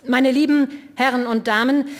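speech opening. A narrator's reading. Liborius opens his speech again.

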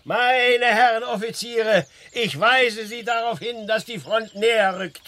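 high, loud formal address to the officers; officer's announcement. Acted. An officer warning the gentlemen officers, high and loud. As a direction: proclaim it like an officer at a briefing.

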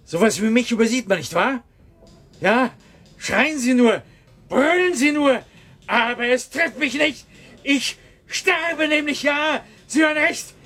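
high, frantic rant rising to a cracked wail; hysterical outburst. Acted. Clerk raving at the director he's dying, frantic and high. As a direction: unravel into a desperate high cry, stretching out sterbe.

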